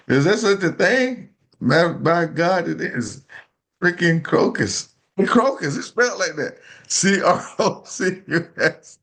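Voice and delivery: excited disbelief. Real, not acted. Host reading that crocus is real, laughing excitedly. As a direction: crow with laughter at the odd word.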